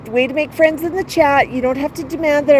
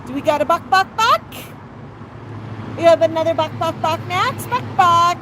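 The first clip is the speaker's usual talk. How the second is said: higher, playful "buck, buck" clucks; chicken clucking; 6 semitones higher than usual. Acted. Owner coaxing her parrot to cluck for viewers, high playful clucks. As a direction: cluck like a hen to coax the bird.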